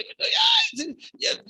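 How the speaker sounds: a sudden high, loud exasperated ay; mock exasperated cry. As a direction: fling up your hands in mock despair. Mock exasperation in quoted argument. (ay exasperado y agudo, en burla)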